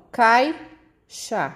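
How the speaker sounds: "caixa" slowly as cai... xa; two separate syllables, cai, then xa; split into syllables. Shown as a demonstration. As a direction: pause between cai and xa in "caixa". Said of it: "Quando eu separo essa sílaba".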